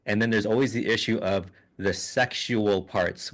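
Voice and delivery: usual speaking voice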